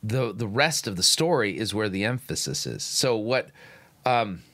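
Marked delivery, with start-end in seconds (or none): none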